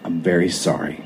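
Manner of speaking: quietly reassuring the angry husband, almost whispering; calm quiet voice. A narrator's reading. Soft calm explanation to Scott so he stays calm. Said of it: "calm, quiet, almost whisper of a voice".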